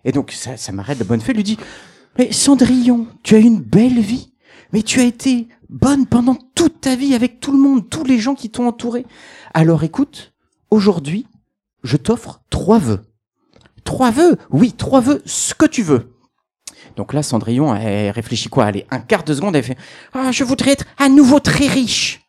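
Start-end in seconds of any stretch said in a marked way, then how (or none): 2.2-13.0 higher, kindly fairy voice, slowing on the offer; a lightly voiced godmother granting wishes. Godmother offers Cendrillon three wishes, lightly voiced. 13.9-16.1 higher, surprised question; a lightly voiced surprised old woman; surprised echo. Cendrillon hears about the three wishes, surprised. 20.1-22.2 high, slow, dreamy wish; a lightly voiced old woman making a wish; old lady's wish. Cendrillon makes her first wish, high and slow.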